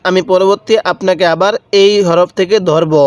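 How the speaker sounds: normal talking voice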